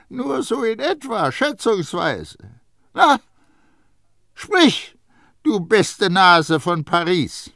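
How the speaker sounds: high, loud, mocking old perfumer; Baldini. A narrator's reading. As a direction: needle the boy with high-pitched scorn. Baldini voiced by narrator.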